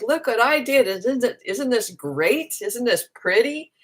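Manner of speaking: high, bright boastful look-at-me voice; mock show-off. Showing people paintings, in a mock high boastful voice. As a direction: puff up like a proud child showing off. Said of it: "it's not like".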